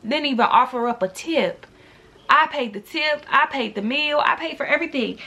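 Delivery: ordinary delivery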